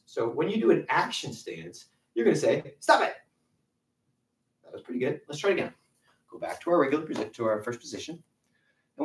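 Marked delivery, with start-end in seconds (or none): 2.8-3.1 firm stop command. Shown as a demonstration. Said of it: "the stop sign is also with your voice".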